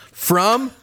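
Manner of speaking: mock-grand proclamation of a hometown; Patrick J. Carter. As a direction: draw out the sign-off, then leap way up in pitch. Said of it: "do it in this guy's voice".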